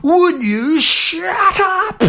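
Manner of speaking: very high, slow, stretched-out yell; mock angry yell. Fed-up man yelling at the barking dog, re-enacted high holler. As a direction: holler it over the fence, dragging each word. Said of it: "It was pretty loud".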